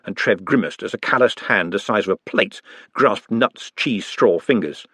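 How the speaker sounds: usual speaking voice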